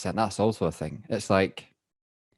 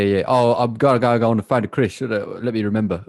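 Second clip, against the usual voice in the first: ordinary delivery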